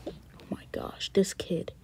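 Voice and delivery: breathy fed-up whisper; exasperated whisper. Acted. Dad fed up with his sneaking son, whispering. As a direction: whisper your exasperation at the kid.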